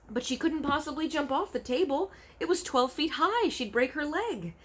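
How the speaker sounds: put-on a frightened little girl; Sophie thinking. A narrator's reading. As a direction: worry it through.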